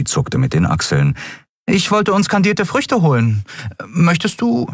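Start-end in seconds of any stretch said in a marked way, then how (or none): 1.6-4.7 put-on higher, light young woman; young woman offering candied fruit; Andriel, breezy. A narrator's reading. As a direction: offer it casually. Andriel voiced in story.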